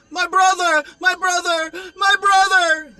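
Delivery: high, loud frantic scream of my brother. Retold or imitated. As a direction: scream it out in panic, voice cracking high. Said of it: "almost with an African accent".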